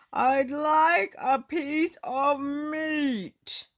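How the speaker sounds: bellowing for bread; shouting. Acted. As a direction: shout it, drawn out. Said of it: "Mister Noisy shouted".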